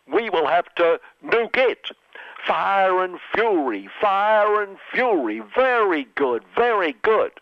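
hammed-up Trump bluster rising; mock Trump threats.

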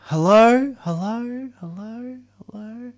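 high call fading away like an echo; high-pitched hello echoing into the distance. Acted. Calling into echoing empty body space.

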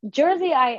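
Jersey pitched high, Jersey accent; New Jersey accent. Shown as a demonstration. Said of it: "We need some of the accent".

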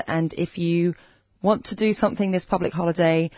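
usual speaking voice

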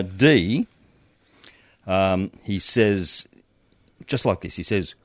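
ordinary delivery

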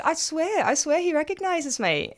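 high-pitched retold insistence; insistent protest. Retells protesting to doubting boyfriend.

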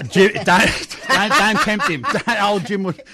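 loud laughing protest; laughing while pleading don't. Real, not acted. Reacting to a Chatto joke.

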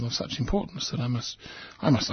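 usual speaking voice

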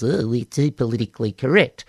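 put-on croaky old politician voice; John Howard croaky voice. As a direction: rasp it out like a weary old PM. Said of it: "in my best croaky voice".

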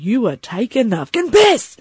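high, exasperated outburst; retold anger. Patient fed up with nurse on hotline, retold anger. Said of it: "I'm so angry by this stage".

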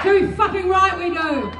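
defiant rally shout; fiery revolutionary speech. Real, not acted. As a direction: punch it out to the crowd. Answers own chant.

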